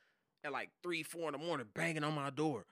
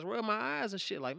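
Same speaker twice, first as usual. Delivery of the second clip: normal talking voice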